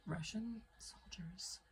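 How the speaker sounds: slow, whispered revelation; hushed whisper. Real, not acted. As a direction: whisper it like a secret. Hushed reveal of her suspicion.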